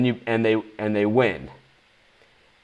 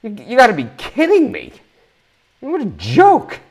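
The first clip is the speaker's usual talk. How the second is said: exasperated outrage; 11 semitones higher, 4 dB louder than usual. Real, not acted. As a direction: vent real disgust. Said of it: "I'm upset".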